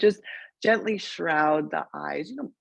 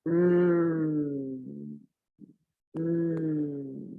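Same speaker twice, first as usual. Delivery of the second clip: long, steady closed-lip hum; resonant hum. Shown as a demonstration. Yoga teacher showing a resonant sound, long steady hum. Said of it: "a resonant sound like".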